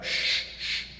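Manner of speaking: two long shushes; sharp "shh, shh"; urgent shushing. A narrator's reading. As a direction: hush your listener. Rabbit hushes raccoon.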